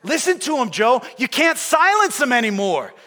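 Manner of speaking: high, loud Brooklyn newsboy shout; Jack, Brooklyn accent. Acted. Striking newsboy defying the newspaper boss, loud Brooklyn accent. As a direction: call it out defiantly in Brooklynese. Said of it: "in his Brooklyn accent".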